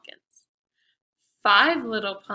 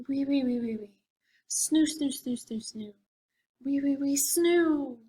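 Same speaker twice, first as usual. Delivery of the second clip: snoring sounds; 3 semitones higher than usual. Acted. Little mummy asleep, gentle snores fading out.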